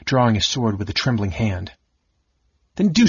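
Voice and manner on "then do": higher and louder, sharp; snapped. A narrator's reading. Raistlin daring his brother, sharp snap. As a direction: bite the words off. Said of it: "Raistlin snapped".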